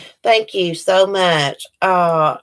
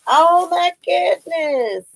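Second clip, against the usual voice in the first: much higher, slow delighted gushing; 8 semitones higher, slower than usual. Real, not acted. Opening another gift from Shirley, high slow delight. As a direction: swoon over it.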